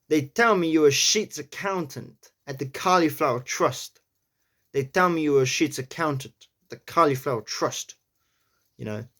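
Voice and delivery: amateur gangster-style New York accent. Shown as a demonstration. Accent learner attempting the tricky sentence twice, New York accent. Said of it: "in a New York accent".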